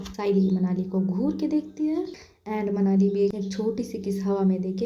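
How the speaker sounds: usual speaking voice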